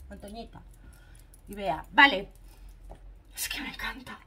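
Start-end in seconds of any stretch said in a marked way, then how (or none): 3.4-4.2 soft whisper, no voice; whispered aside. Real, not acted. Telling viewers she loves it, in a whisper. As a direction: confide it in a whisper.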